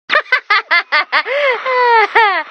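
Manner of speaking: a short laugh that falls away; amused laugh. Acted. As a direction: laugh at your own joke. Laughs at own joke.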